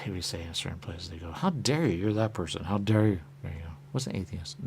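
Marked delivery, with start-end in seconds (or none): 1.3-3.2 mock indignation. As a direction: scold them, slightly raised. Quoted outraged figure.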